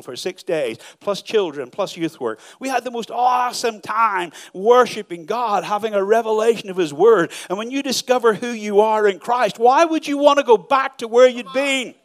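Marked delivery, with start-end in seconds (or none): 2.6-11.9 higher, louder, climbing preacher's cry; full-force preaching. Acted. Pastor urging the congregation, rising preaching. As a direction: lift the room with every line. Preaching climax, loud and high.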